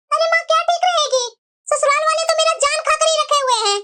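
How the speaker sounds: petulant daughter airing grievances; complaining daughter. Acted. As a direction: sulk and complain to mom.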